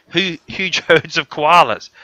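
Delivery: can't keep a straight face on the lie; laughing. Real, not acted. Laughs at his own tall tale.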